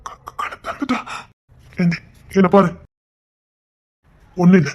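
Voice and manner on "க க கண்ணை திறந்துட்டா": breathless whispered stammer; stunned father whispering that his daughter opened her eyes. Acted. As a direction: barely get the words out in relief. Stunned relief at bedside.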